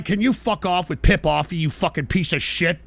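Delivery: ordinary talk, nothing marked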